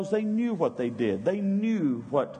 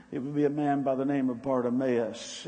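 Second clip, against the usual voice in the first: ordinary talk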